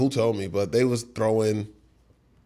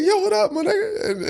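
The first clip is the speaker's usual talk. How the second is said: excited greeting. Retold or imitated. Greeting [name] at the party, high excited call. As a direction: greet him like a long-lost friend.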